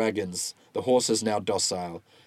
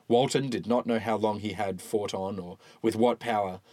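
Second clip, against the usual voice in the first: usual speaking voice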